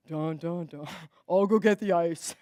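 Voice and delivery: low, sheepish dun dun dun; sheepish intern owning his mistake, low and deflated; deflated groan. Retold or imitated. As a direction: play the caught-out intern sagging.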